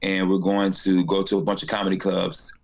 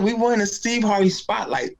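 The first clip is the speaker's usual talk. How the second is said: high excited announcement; 11 semitones higher than usual. Retold or imitated. Husband telling his wife about the win, high and gleeful. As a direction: voice up high with glee. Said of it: "I said".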